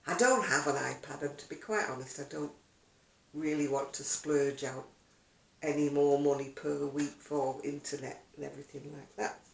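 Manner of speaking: normal talking voice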